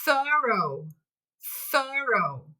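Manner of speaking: "thorough" said twice, slow and clear; teacher spells out "thorough" in sound twice; model word. Shown as a demonstration. Teacher models "thorough" twice for the class. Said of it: "The third word now is".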